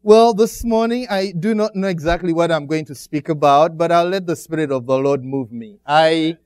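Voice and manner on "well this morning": ordinary talk, nothing marked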